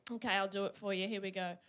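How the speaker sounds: usual speaking voice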